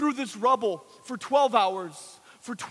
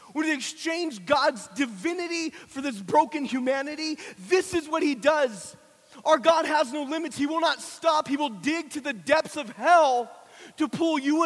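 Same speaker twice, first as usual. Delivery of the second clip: raised, driving sermon voice; full-force preaching; 3 semitones higher, 3 dB louder than usual. Real, not acted. Preacher proclaiming God's rescue from sin, preaching at full force.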